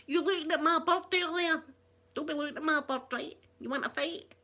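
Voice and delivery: hammed-up street challenge; Glasgow ned. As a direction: play a cocky Glasgow ned picking a fight. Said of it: "it's all the wee Ned's gonna be going".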